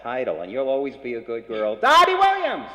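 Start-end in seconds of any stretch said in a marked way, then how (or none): 1.8-2.7 loud, high showbiz announcement of the name; big on-air call to bring the singer up; announcer call. Real, not acted. Host introducing singer to the audience, loud announcer call.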